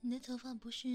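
ordinary talk

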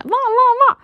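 high-pitched imitated barking; dog bark. Narrator mimicking a dog's sound, high imitated bark. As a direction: yap three times like a dog. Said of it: "就跟狗似的".

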